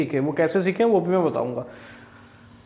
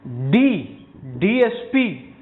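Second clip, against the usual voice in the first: slow pronunciation; 2 semitones higher, much slower than usual. Shown as a demonstration. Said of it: "तो क्या आएगा".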